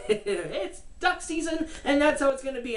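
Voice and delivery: lightly voiced Daffy digging in, half laughing; a hint of a stubborn cartoon duck; mock Daffy Duck. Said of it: "Daffy Duck stupidly goes".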